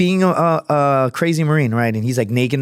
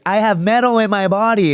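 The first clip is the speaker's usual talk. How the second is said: joking; 8 semitones higher than usual. Retold or imitated. Friend to airport security, high joking voice. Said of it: "he was, like, joking around".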